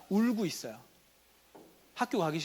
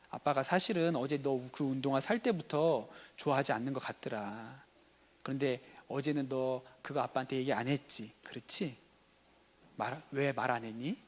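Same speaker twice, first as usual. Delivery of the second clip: soft, lower-pitched, patient questioning; understanding father; 5 semitones lower, 6 dB quieter than usual. Acted. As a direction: play a dad gently probing his son's feelings. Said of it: "진짜 대화를 잘하는 아빠는 이렇게 하는 거야". (낮고 차분하게 묻는 아빠 연기)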